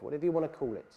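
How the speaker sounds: ordinary delivery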